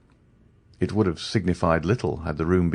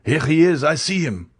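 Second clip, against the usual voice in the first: calling out; 8 semitones higher than usual. A narrator's reading. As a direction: shout him out. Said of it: "cried a voice from the landing".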